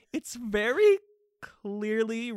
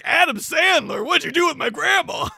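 louder, higher demanding shout; angry Bigfoot; 6 semitones higher, 6 dB louder than usual. Acted. Bigfoot demanding to know where grandma went, louder. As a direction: yell it as an upset Bigfoot.

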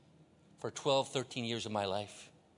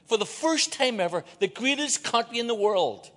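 preaching cry; 8 semitones higher, 8 dB louder than usual. Real, not acted. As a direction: lift it up like a revelation.

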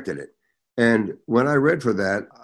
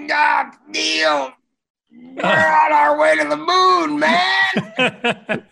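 Buzz Aldrin impression; 4 dB louder, much slower than usual. Voicing Buzz Aldrin in 1969.

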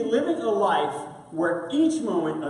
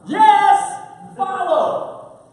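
eager; 11 semitones higher, 8 dB louder, much slower than usual. Retold or imitated. Eager followers mocked.

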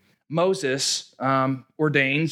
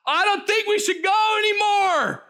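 worried Israelites shouting in alarm, much higher. Retold or imitated. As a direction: yell your worry about giants. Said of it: "voicing their concern".

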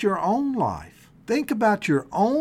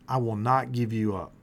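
God's promise, much lower and softer; 10 semitones lower, 6 dB quieter than usual. Acted. Preacher voicing God's gentle promise to the congregation, low and soft.

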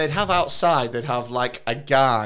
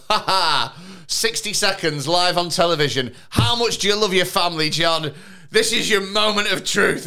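loud, raised showman's announcement; mock game-show host; 5 semitones higher, 4 dB louder than usual. Host putting dad on the spot live, hammed-up announcing. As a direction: build to a big mock TV climax.

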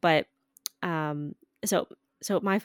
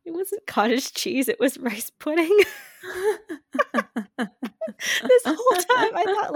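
higher and louder, breaking into laughter; laughing revelation; 11 semitones higher, 7 dB louder than usual. Real, not acted. Telling her co-host the dessert twist, laughing loudly. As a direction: laugh through the reveal.

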